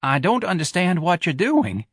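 bewildered clerk. Acted. Bewildered manager.

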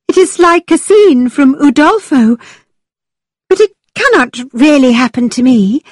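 put-on eager girlish voice, high; bright high young woman; young heroine. A narrator's reading.

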